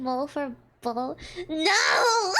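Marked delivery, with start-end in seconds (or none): 1.5-2.3 loud, high laughing no; laughing shout. Real, not acted. Streamer refusing chat's idea, loud and laughing.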